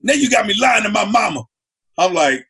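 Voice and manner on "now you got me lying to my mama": loud, higher scolding rant; scolding girlfriend. Retold or imitated. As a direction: tell him off for losing the keys. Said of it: "She like".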